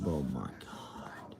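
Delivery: slow, breathy exasperated whisper under the breath. Real, not acted. Streamer fed up with repeated chat requests, whispering slowly. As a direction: sigh it out slowly, barely voiced.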